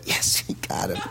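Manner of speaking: hushed low whisper of victory; whispered triumph. Retold or imitated. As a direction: gloat in a whisper.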